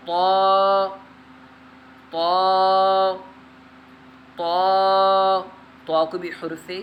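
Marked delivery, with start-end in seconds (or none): 0.1-5.4 طا said heavy and full, a little louder; طا read heavy; heavy letter. Shown as a demonstration. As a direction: give طا a thick, heavy sound. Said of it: "موٹا پڑھنا ہے".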